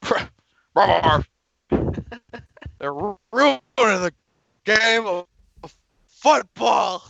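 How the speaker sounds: hamming a Madden impression for friends; hammed-up Madden. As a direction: rant like an old broadcaster. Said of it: "uncanny John Candy of John Madden".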